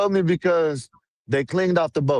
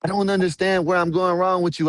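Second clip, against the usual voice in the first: normal talking voice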